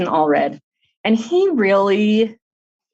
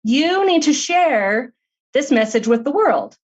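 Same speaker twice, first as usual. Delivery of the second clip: higher, eager and insistent, slowing on the plea; excited therapist; 7 semitones higher than usual. Retold or imitated. Therapist thrilled after reading the book, urging Heidi, excited higher voice. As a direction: urge her to share it, thrilled. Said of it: "She was so excited".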